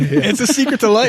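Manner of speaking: high, loud mock rave; playful over-the-top high praise; mock hype. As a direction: gush about it like a zealot. Joking hype continues.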